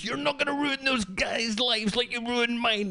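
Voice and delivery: high, strained accusing voice; a homeless man accusing the cop, in a high strained voice; ranting homeless man. Retold or imitated.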